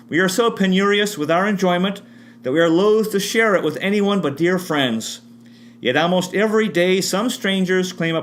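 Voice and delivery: reading Martha's letter. Shown as a demonstration. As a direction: read the letter aloud, a touch lifted and measured.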